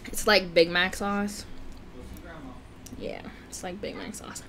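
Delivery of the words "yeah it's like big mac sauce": breathy whispered aside; whispering. Real, not acted. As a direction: whisper to yourself. Muttered aside during mukbang.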